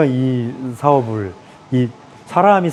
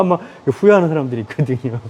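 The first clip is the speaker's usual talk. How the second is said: ordinary talk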